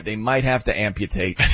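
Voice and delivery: low-pitched dry verdict; deadpan mock concern. Fan repeating his verdict on a writhing player, deadpan. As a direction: deliver the overblown diagnosis drily. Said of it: "And I'm like".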